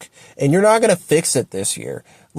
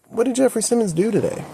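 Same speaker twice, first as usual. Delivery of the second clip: ordinary talk, nothing marked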